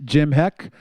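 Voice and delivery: ordinary talk, nothing marked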